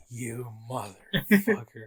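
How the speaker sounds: slow, drawn-out accusation; mock outrage. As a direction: stretch it out in betrayed disbelief. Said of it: "people are like".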